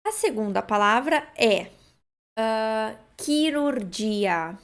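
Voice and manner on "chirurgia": chirurgia slow, starting with a hard kee; slow teacher demo; careful model word. Shown as a demonstration. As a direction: model chirurgia for students, k sound first. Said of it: "Começa com chi".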